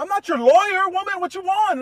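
very high, very loud retort; exasperated yell. Retold or imitated. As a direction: yell back at full volume in an acted quarrel. His shouted side of acted quarrel.

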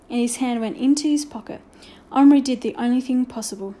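usual speaking voice

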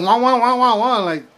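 sing-song mocking wah wah; mock whining wah-wah. To a negative friend, mocking wah-wah noises. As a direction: imitate a whiny complainer with wah sounds.

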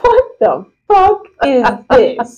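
slow, drawn-out incredulous question; laughing disbelief. Real, not acted. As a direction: space out each word in amused disbelief. Stunned reaction to the article.

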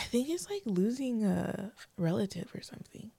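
tearful whisper; words breaking into tears; crying. Real, not acted. Telling her husband she feels a loss, whispering through tears. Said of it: "I need tissue".

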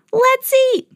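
high loud excited cartoon cry; eager cry. Hungry farmers at the castle table, a high eager cry. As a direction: squeal it with hungry glee. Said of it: "they cried".

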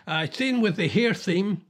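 usual speaking voice

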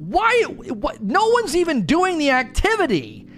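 high, mock-furious string of questions; spitting nails. Story team ranting about the broken activity, high mock fury. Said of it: "You would be spitting nails".

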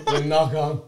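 giggling through the words; laughing. Retold or imitated. Keeps the bird role, laughing.

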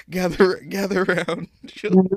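horror host. Pretend. Pretend storyteller summoning children.

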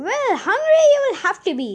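high, loud scolding retort; indignant. A narrator's reading. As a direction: snub him with loud indignation. Angry woman refuses him breakfast.

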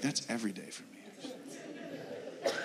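a weary whispered aside from the crowd; tired under-the-breath joke, half laughing; barely voiced mock complaint; mock congregant. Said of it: "Some of you are like".

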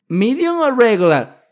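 high, slow, loud question; high loud server. Retold or imitated. Server asks size. (dependienta preguntando tamaño, aguda y lenta)

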